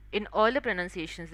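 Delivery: normal talking voice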